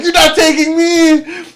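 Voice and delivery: hammed-up high, loud cry, words dragged out; retold defiant yell. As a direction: act out the knife-waving uncle's yell. Retold panicked uncle's outburst.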